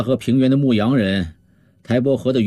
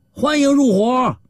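high, drawn-out welcoming shout; stretched, high-pitched hearty call; rousing group cheer; 13 semitones higher, slower than usual. Acted. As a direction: call it out like a band of bandits hailing a recruit. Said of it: "好几个强盗齐声叫喊起来".

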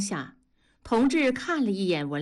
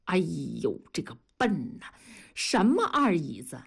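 mocking; 3 semitones lower than usual. A narrator's reading. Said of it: "嘲笑道".

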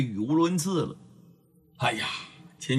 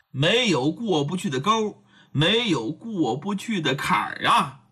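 plain reading voice

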